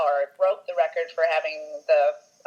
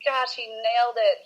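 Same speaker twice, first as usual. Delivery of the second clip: gushing reenacted reaction; 6 semitones higher than usual. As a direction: draw it out in admiring amazement. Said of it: "I'm like".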